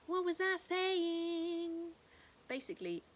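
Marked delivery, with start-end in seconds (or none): 0.0-1.7 silly mock confusion. Real, not acted. Playful self-question.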